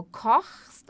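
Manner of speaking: kochst with a rough ch made in the throat; throaty ch. Shown as a demonstration. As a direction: scrape the ch of kochst at the back of the throat. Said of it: "o C H que a gente faz aqui na garganta".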